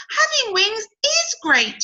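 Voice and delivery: delighted with her new wings; proud butterfly. Acted. Cody flies proudly.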